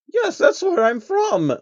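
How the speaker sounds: high, wondering princess voice; a pony princess amazed by a stranger; high and slow in wonder; awed princess. Acted. Luna voiced.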